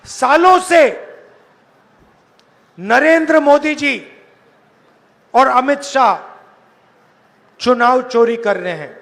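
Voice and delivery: shouting to a big crowd; full-voiced rally oratory; booming political rally speech. Real, not acted.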